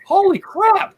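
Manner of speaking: high-pitched startled exclamation; mock-stunned outburst; retold shock.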